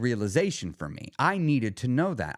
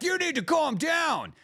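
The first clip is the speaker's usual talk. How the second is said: mock yell; slower than usual. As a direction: yell "You need to calm down" at them. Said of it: "but really, you're saying, like".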